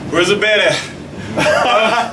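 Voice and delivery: tired laughing, slow. Real, not acted. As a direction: laugh it off, worn out. Exhausted after the sprint.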